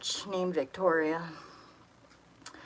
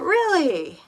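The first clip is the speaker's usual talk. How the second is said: much higher, louder shocked "Really?"; shocked disbelief; 11 semitones higher, 9 dB louder than usual. Retold or imitated. Told she was brought to work the streets, stunned disbelief. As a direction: gasp it out in sudden disbelief.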